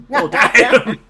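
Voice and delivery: loud, high, laughing outburst; shocked reaction. Retold or imitated. Reacting to the film's insult, loud laughing shock.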